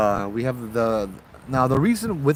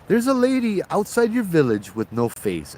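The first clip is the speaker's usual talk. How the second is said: a touch of irritation, pitch up, scolding the villagers; the traveler scolds the man fixing his cart, mildly annoyed; annoyed complaint; 7 semitones higher than usual. Acted. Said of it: "He's like".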